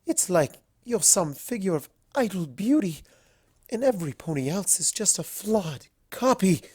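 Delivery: high, admiring society-lady voice; gushing fashionable unicorn. Acted. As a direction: gush it, high and enthusiastic. Rarity voiced by narrator.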